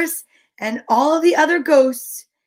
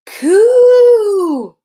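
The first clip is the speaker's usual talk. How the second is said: high loud ghostly wail; wailed coo; 9 semitones higher, 9 dB louder than usual. Acted. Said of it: "he wailed".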